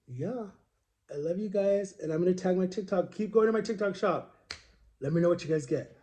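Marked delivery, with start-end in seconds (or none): none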